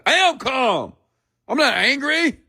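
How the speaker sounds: high-pitched angry protest; mock angry denial. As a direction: insist you're calm in an exaggerated, high-strung temper. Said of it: "a person is angry".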